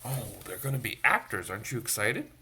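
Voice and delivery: ordinary delivery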